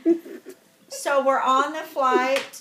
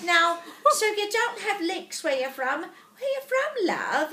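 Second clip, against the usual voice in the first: higher mock English lady; English accent; 4 semitones higher than usual. English lady asking where her seatmate is from, imitated English accent. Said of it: "from England".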